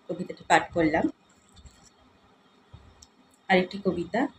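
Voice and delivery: usual speaking voice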